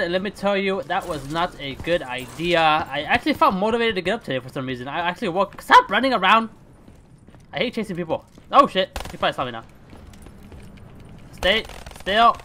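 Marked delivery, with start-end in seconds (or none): none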